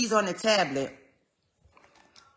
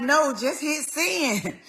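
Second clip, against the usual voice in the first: higher and slow, urging her on; eager coaxing; 7 semitones higher, much slower than usual. Retold or imitated. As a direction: coax mom to just press send. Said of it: "I'm like".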